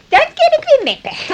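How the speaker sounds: can't wait to bash the drums; excited drummer girl; gleeful. Acted. Girl eager to drum again.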